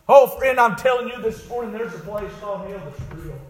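high, loud revival preacher; impassioned preaching. Acted. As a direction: preach it urgently at full voice. Preaching at full force.

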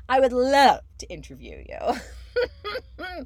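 slow laughing plea. Real, not acted. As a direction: crack up while asking.

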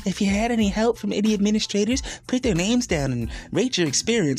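high, put-on official reading; mocking recital of printed instructions; mock form voice. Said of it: "at the back of the sheet talk about some".

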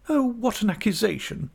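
high-pitched, indignant exclamation; shocked young wife. A narrator's reading. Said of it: "exclaimed Dora, opening her eyes wide".

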